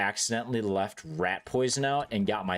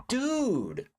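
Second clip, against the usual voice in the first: shocked dude. Retold or imitated. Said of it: "I'm like".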